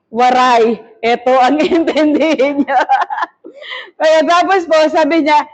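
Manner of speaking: usual speaking voice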